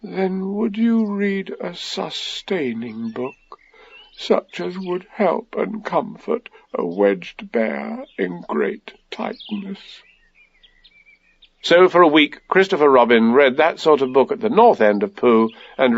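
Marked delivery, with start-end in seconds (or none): 0.0-10.0 high, soft, slow forlorn plea; drawn-out, pitiful character voice; tearful. A narrator's reading. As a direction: plead in a small, sorrowful voice. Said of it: "a tear rolled down his eye".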